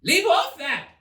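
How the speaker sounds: high piercing royal scream; queen's scream. A narrator's reading. The queen screams at the bowing gardeners, shrill high scream. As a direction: shriek it at the courtiers. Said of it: "screamed the queen".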